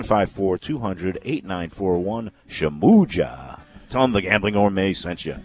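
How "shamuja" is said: Shamuja stretched slow and low; signature Shamuja call. Shown as a demonstration. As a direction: drawl Shamuja out like a jingle. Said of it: "he loves the way I say it".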